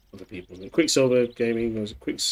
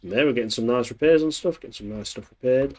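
ordinary talk, nothing marked

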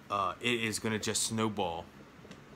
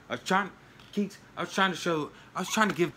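higher and louder, earnest pleading to the pet; pleading with the dog; 8 semitones higher, 4 dB louder, faster than usual. Real, not acted. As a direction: plead with her that you meant well. Explaining to the upset dog.

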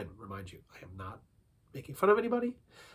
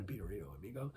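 soft, low Mexican accent; murmured aside in a Mexican accent; 7 semitones lower, 17 dB quieter than usual. Shown as a demonstration. Noting that it rhymes, soft Mexican accent. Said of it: "or Mexican".

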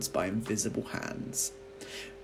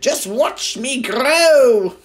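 higher, louder, slow gloating crone; screechy old grandma; 13 semitones higher, 14 dB louder, much slower than usual. A narrator's reading. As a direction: drag out the boast like a cackling witch. Grandma boasting, growing wildly.

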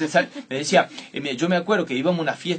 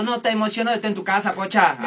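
sweet-talking mother; 8 semitones higher, 5 dB louder than usual. Retold or imitated. Mother covers up to aunt.

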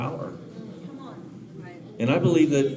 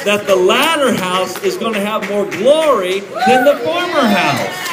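loud, high rising proclamation; preaching shout; 7 semitones higher, 7 dB louder than usual. Real, not acted. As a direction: build to a shout, slow on former house. Preacher peaks on scripture.

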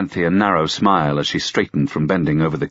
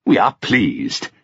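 put-on old king; much slower than usual. A narrator's reading.

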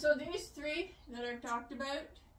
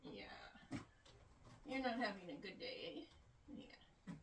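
hushed pet talk; 3 semitones lower, 13 dB quieter than usual. Real, not acted. Hushed talk to unhappy rabbit.

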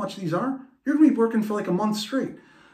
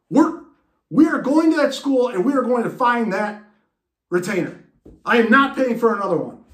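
loud, higher commanding dad; determined dad; 6 semitones higher, 5 dB louder than usual. Retold or imitated. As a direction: order the kid into the car. Dad insists.

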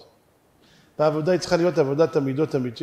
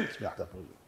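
very soft, low falling "תפוזים"; hushed matter-of-fact confirmation; low answer; 10 semitones lower, 18 dB quieter than usual. Acted. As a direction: answer under your breath, dropping on "תפוזים". Answers own question.

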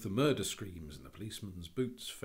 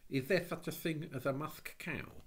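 put-on high-pitched worried child; fretful questioning; anxious girl; 8 semitones higher, 3 dB louder than usual. A narrator's reading. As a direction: ask with mild, childlike anxiety. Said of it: "she added anxiously".

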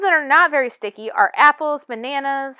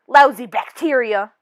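louder grumbling anger; 7 dB louder than usual. Acted. Tooth angry at bacteria, loud grumbling anger. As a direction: spit it out at the germs.

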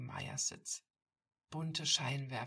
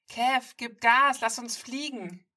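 excited shout; 9 semitones higher, 16 dB louder, slower than usual. Acted. Girl urging him to speed up.

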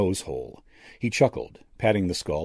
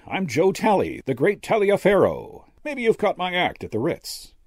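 high-pitched, showy stage magician; brash magician; 11 semitones higher, 3 dB louder than usual. A narrator's reading. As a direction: introduce yourself like a cocky showman, pitched up.

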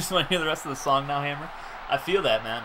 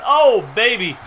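loud burst of delight; real hype yell; excited exclamation; 6 semitones higher, 10 dB louder, slower than usual. Real, not acted. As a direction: holler at the big play. Big hit on defense.

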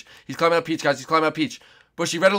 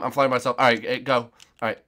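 normal talking voice

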